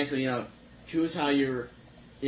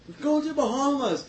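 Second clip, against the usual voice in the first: excited; 11 semitones higher than usual. Retold or imitated. Terrorists cheering over virgins.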